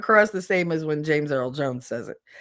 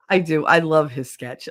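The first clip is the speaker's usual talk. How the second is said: ordinary talk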